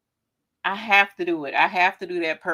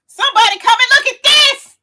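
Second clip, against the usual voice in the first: excited calling out; 11 dB louder than usual. Real, not acted. Calls others to see jersey.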